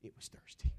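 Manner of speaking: loud whispered aside as the wife; mock wifely hiss; insistent stage whisper. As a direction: whisper it forcefully to onlookers. Wife's aside to onlookers.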